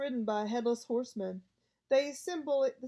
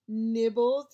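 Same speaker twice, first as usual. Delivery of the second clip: mock-sweet offer, a touch louder; sweetly; 8 dB louder than usual. A narrator's reading. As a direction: lay the sugary charm on thick. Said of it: "he said sweetly".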